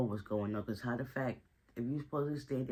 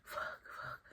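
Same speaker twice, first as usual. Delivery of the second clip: breathy whispered fuck, under the breath; voiceless despairing whisper; whispered curse; 8 dB quieter than usual. Retold or imitated. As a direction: curse to yourself in a hushed whisper after she leaves. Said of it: "He's out inside crying".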